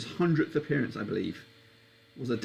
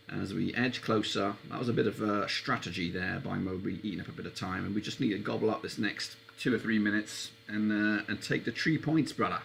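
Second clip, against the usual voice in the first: normal talking voice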